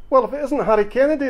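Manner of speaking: high, loud hail from the barman; called-out greeting. A narrator's reading. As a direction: call it out across the bar. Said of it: "the barman called out".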